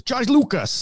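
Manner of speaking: lightly done Walken drawl; a hint of Walken, rising on Lucas; Christopher Walken impression. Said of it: "You doing Christopher Walken?".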